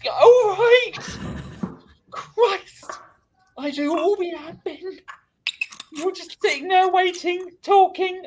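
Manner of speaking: high, loud whining; mock whiny complaint. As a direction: moan about doing all the work. Mock complaint about doing admin.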